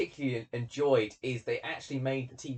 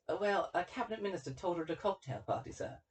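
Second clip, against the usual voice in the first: Elliot answering the Colonel; 5 semitones higher, 5 dB quieter than usual. Acted. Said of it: "And Elliot goes".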